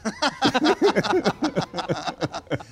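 high loud laugh bursting out; burst of laughter. Real, not acted. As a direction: crack up laughing. Laughs at parade story.

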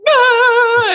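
overwhelmed outcry. Retold or imitated. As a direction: burst out in a high yell of frustration. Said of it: "And I just went".

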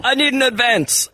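high squeaky demanding voice; mock [name] voice. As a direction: pitch it way up, comic. Acting [name] at label meeting.

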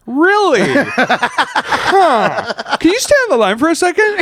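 high, fast eager put-on voice; mock intrigued. Caller hearing cops die plays along, high and eager. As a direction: sound suddenly very interested. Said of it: "You're like".

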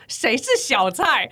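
slow, drawn-out playing dumb; feigned puzzlement. Pretending ignorance to the taxi driver, slow and puzzled. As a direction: stretch it out, pretending not to know. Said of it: "然后我想说".

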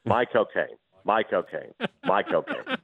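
"My cocaine" as a lightly voiced Cockney "Michael Caine"; Michael Caine name. Shown as a demonstration. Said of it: "my cocaine is how Michael Caine says his name".

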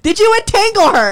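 very high, loud theatrical yell; hammed-up shrieked question; shouted interrogation. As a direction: belt out the accusation. Acting out escalating interrogation.

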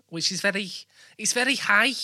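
high-pitched attempt at Scouse; Liverpool accent. Host demonstrates Liverpool for guest, high Scouse accent. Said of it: "then there's Liverpool".

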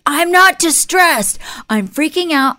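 high, loud mock protest; hammed-up frantic outburst; freaked-out denial. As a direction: imitate a stressed guy insisting he isn't. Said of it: "is incredibly stressed out, freaked out".

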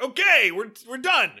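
mock band leader calling it. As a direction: announce the dance is over. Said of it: "prompts the band leader to be like".

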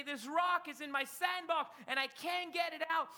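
high, mock-upset little boy; acted childish wail in words; imitated tearful boy. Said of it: "he begins to cry and say".

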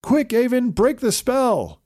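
high, loud urgent call; urgent shout. A narrator's reading. Troll holding Scorpio, urgent shout. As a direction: yell it while holding him down. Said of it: "Troll said".

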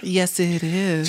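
slow, lightly faked woman's voice; a slow mock female voice; put-on woman's voice. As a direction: pretend to be Jessica on the phone. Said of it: "Why are you doing that voice for work?".

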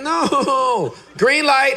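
high laughing "No, green light"; laughing protest. Retold or imitated. Preacher retelling his laughing disbelief at God's answer. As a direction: laugh through the words. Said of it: "And I'm like".

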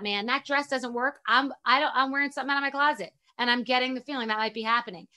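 ordinary talk, nothing marked

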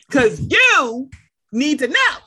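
loud, punched-out declaration; emphatic mock scolding. On the back channel with Jesus, loud and emphatic. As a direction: punch out each word loudly.

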